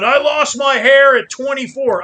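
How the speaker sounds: hammed-up moan about going bald; mock whining. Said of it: "do I go around going".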